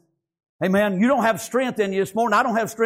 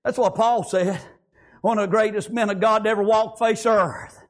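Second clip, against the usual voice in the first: ordinary delivery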